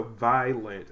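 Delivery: "violent" slowly as "vi... lent"; split into syllables. Shown as a demonstration. Teacher showing where to separate it, slow syllables. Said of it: "where I separate it".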